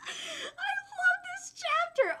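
high, slow, tearful fan squeal; gushing squeal. Real, not acted. Reader loves the manga chapter, squealing with joy. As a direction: squeak it out slowly, overcome with delight.

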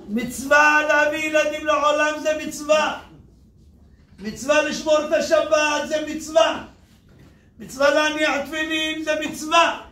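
fiery sermon voice; preaching at full force. Acted. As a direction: preach it to the whole hall. Preacher rousing the congregation.

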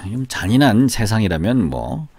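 ordinary talk, nothing marked

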